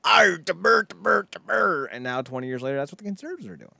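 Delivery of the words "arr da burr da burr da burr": higher, louder mock rant into garbled grumbling; cussing liberal. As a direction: imitate an indignant liberal, ending in gibberish. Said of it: "liberals were the ones that were swearing and cussing".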